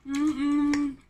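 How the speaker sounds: ordinary talk, nothing marked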